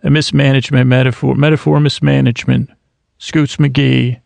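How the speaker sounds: ordinary talk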